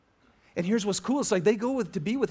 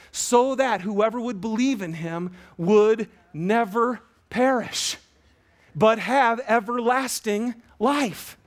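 rising, slow, louder preaching voice; 3 semitones higher, 4 dB louder, slower than usual. Acted. Pastor to congregation, building verse slowly. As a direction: lift the promise of the verse slowly.

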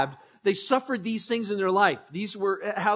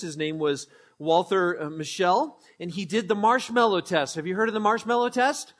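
ordinary talk

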